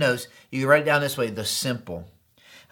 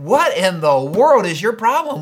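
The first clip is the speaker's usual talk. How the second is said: louder, higher exasperated retort; sheer frustration; 4 semitones higher, 5 dB louder than usual. Retold or imitated. Pastor to trip leader after leaving cab, frustrated. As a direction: punch it out in retold exasperation. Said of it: "just, just frustration".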